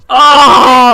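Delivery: loud yelp breaking into laughter; real yelp of pain; pained laughing yelp. Real, not acted. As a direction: shout then laugh at the sting. Stung hand after slapping.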